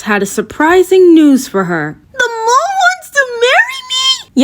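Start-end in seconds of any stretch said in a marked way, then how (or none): 2.1-4.4 much higher, shrill disbelief; horrified squeal. Acted. Thumbelina hearing the mole wants to marry her, shrill and horrified. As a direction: squeal it in horror.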